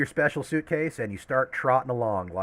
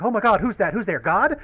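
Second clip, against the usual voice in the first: startled; 9 semitones higher, 6 dB louder than usual. Acted. Kids startled by a snap.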